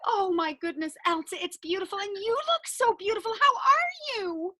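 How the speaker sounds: high, breathless-with-delight gushing; gushing admiration. Pretend. Host admires Elsa.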